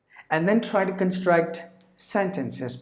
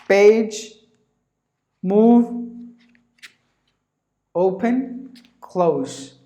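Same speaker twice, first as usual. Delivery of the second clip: loud, one word at a time, each word punched; listing basic words; 5 semitones higher, 6 dB louder, much slower than usual. Shown as a demonstration. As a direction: call out each word like a teacher's list. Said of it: "All these words you know".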